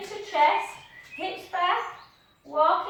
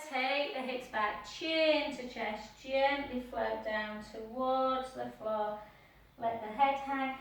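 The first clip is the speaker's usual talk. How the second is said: slow, calm instructor cues; soothing yoga teacher voice; yoga cueing; 4 semitones lower, 7 dB quieter than usual. Acted. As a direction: guide the stretch gently and slowly.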